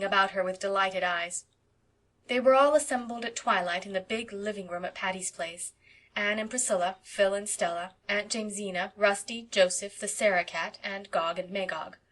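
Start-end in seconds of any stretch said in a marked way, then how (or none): none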